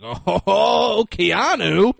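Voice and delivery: loud, high, drawn-out mock delight; hammed-up excitement. Dennis Hopper's character watches the cop board, hammed-up excitement. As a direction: imitate a villain thrilled to see his rival. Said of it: "He gets all excited".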